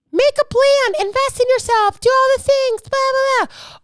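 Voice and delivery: high mock-preachy chant; shrill-pitched nagging sermon parody. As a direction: holler it high like a hammed-up preacher. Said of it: "preachy, preachy, preachy".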